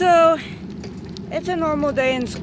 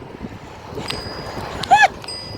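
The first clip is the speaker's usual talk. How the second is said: airy high laugh; high breathless laugh. Real, not acted. Laughing at the memory.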